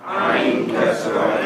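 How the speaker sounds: normal talking voice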